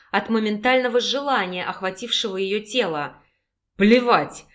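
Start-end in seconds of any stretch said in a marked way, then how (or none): 3.8-4.4 louder, short growled dismissal. A narrator's reading. Husband brushes off his wife's objection, growled. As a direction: bark it like a wolf. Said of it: "рыкнул мужчина".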